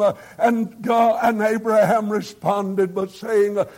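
ordinary talk

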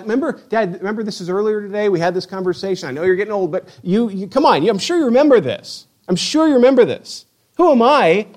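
acted exasperation, rising to a peak; incredulous pleading. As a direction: press a forgetful father to remember. Son pleads with forgetful father.

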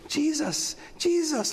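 put-on a little girl pointing at a picture, delighted. Little girl pointing at the manger picture, high and eager. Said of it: "She goes".

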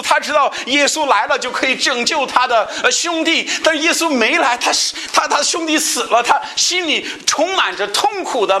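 high, intense sermon voice; impassioned pulpit delivery; fervent preaching. Acted. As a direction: preach it out with rising fervor. Preaching at raised pitch.

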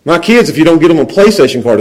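ordinary delivery

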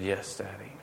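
put-on hushed child's confession; small tearful yes. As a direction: barely say it, like a sad child on dad's lap. Son admits angry thoughts, tearful.

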